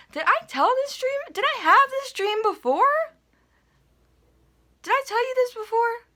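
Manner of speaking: squeaky high-pitched sudden doubt; sudden high squeal. Real, not acted. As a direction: suddenly shoot up high, wondering aloud. Suddenly wondering if it was told.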